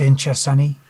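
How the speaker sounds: bad East End Cockney accent; hammed-up Cockney accent. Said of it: "I'll be doing a bad East End Cockney accent".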